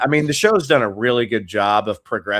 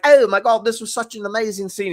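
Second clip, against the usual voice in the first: giddy, high-pitched British gush; mock giddy British actor; Andrew Lincoln; 9 semitones higher than usual. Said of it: "giddy British guy".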